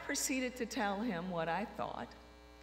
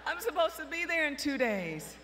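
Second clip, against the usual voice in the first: panicked plea; 4 semitones higher than usual. Retold or imitated. Panicked pleading with the judge.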